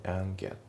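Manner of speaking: AND GET as "an-get"; no D in AND before GET; dropped D. Shown as a demonstration. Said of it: "andのDがね".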